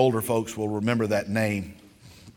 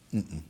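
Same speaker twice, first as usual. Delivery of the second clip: stubborn refusal hum; 6 semitones lower, 9 dB quieter than usual. Retold or imitated. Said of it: "He goes".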